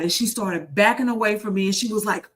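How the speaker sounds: ordinary talk, nothing marked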